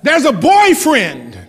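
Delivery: put-on slow, with "boyfriend" punched on its first part, boy; stress on boy. Shown as a demonstration. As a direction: lean hard on the boy in "boyfriend". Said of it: "Emphasis on boy".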